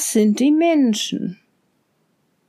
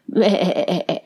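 a short low goat bleat; 4 semitones lower than usual. Acted. Mother goat bleating goodbye to her kids, short and low. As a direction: bleat once like the old goat.

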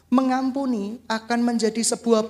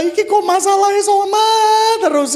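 keluh frustrasi keras; 9 semitones higher, 7 dB louder than usual. Retold or imitated. Pendeta frustrasi masalah sama terus.

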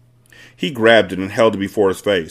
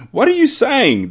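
very high, appalled cry; husband's alarmed outburst; 4 dB louder than usual. A narrator's reading. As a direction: cry out in disbelief at the wife. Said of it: "the husband cried".

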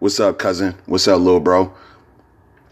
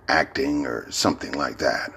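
normal talking voice

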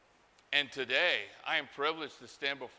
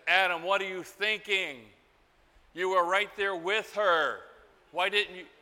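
exasperated rebuke; 4 semitones higher, 4 dB louder than usual. Acted. Exasperated question to Adam.

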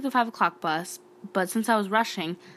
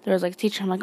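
ordinary delivery

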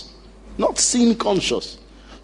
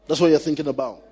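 ordinary talk, nothing marked

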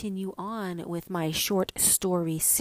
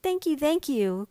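put-on high, eager little-girl thanks; a child's happy outburst; 7 semitones higher than usual. A narrator's reading. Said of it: "cried Mink".

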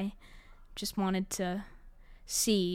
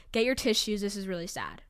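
ordinary talk

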